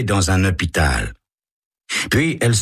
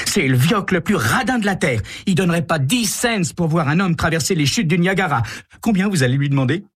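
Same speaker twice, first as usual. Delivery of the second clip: put-on much higher, scornful teen voice; bratty rich girl; 12 semitones higher than usual. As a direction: sneer at daddy, high-pitched. Carole mocks her stingy father.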